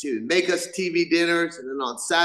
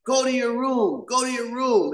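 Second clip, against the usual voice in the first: mom scolding; 6 semitones higher than usual. Retold or imitated. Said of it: "My mom be like".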